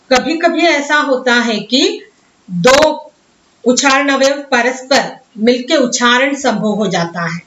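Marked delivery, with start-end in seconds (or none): none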